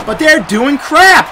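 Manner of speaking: loud, high, slow angry shout; furious yell at the game; angry outburst. Real, not acted. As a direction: blow up at the blockers. Furious at offensive line.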